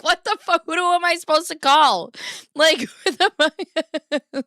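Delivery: high, loud, laughing outrage; laughing disbelief. Retold or imitated. Retelling the deer call to viewers, high laughing outrage. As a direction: shriek it through laughter. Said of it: "I was like".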